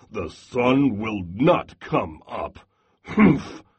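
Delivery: grump it out, then huff; grumbling Bear. Acted. Bear insists all night, low and huffy.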